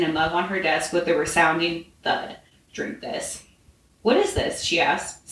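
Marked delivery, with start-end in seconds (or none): 2.7-3.4 low-key, quiet gruff order. A narrator's reading. Man placing a mug on her desk, gruff. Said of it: "he said gruffly". 4.0-4.6 higher-pitched suspicious question. A narrator's reading. Woman sniffing the mug he gave her, suspicious. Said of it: "sniffing it suspiciously".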